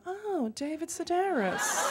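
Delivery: soft, slow audience coo; hushed recognition. Retold or imitated. Whole audience recognizing the famous name, hushed awe. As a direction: breathe it out slowly, suitably impressed.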